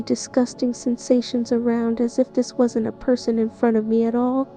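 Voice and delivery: ordinary talk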